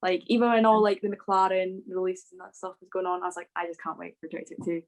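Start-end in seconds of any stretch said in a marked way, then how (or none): none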